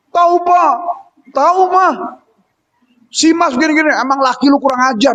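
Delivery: high-pitched whiny daughter telling tales; complaining daughter. Retold or imitated. Daughter to her parents about her husband, high and whiny. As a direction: whine about the husband like a sulky girl.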